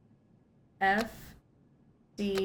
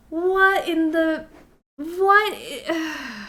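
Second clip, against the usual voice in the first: stunned disbelief; 8 semitones higher, 6 dB louder, much slower than usual. Real, not acted. Game crashed again.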